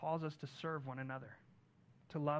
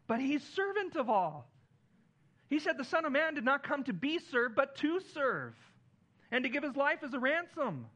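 louder, higher preacher's build on servanthood; preaching at full force; 7 semitones higher, 4 dB louder than usual. Acted. Pastor preaching to the congregation, rising and loud. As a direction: drive the point home from the pulpit.

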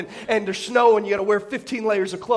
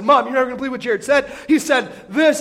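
excited tattling; 4 semitones higher, 5 dB louder than usual. Retold or imitated. Kid tattling to mom.

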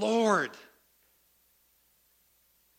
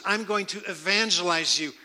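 ordinary delivery

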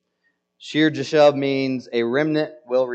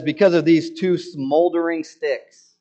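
mocking sarcasm; 5 semitones higher, 4 dB louder than usual. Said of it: "now he makes fun of them".

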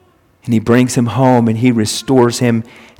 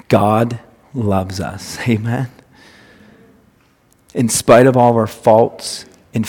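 tender sermon appeal; 3 semitones lower, 6 dB quieter than usual. Acted. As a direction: draw the lost ones gently home.

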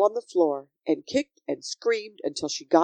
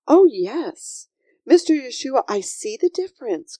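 put-on higher, louder, eager little girl; excited cry; 7 semitones higher, 3 dB louder than usual. A narrator's reading. Sophie suddenly understands Yeshua, crying out eagerly. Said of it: "cried Sophie".

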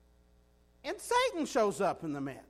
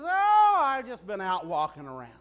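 mock innocent, breezy and louder at the end; innocent Satan; 5 dB louder than usual. Satan answering God with feigned innocence, breezy. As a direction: play Satan shrugging it off, all sweetness. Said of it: "Satan says".

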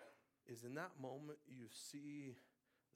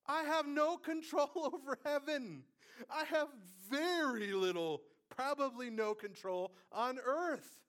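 high, loud, impassioned preaching; preaching at full force; 11 semitones higher, 13 dB louder than usual. Real, not acted. Preacher confesses powerlessness to congregation, full-force preaching. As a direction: raise the roof on human helplessness.

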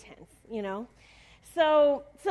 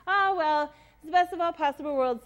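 higher and louder, breezy mock cheer; cheery tone; 4 semitones higher, 6 dB louder than usual. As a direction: brush off disaster brightly, like it's nothing. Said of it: "in a really cheery tone".

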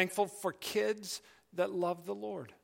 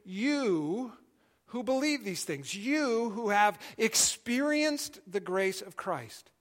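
YOU leaned on, slow and weighty; slow emphatic You who believe; 3 semitones higher, slower than usual. Shown as a demonstration. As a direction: press hard on each YOU, slowly. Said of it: "it's an emphatic you".

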